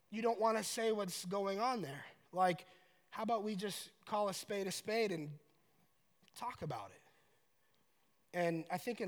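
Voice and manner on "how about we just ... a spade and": ordinary delivery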